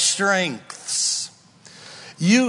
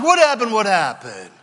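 mock surprise; 3 semitones higher, 3 dB louder than usual. Surprised at falling into sin.

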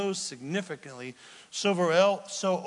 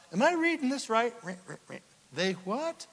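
mock disbelief; 7 semitones higher than usual.

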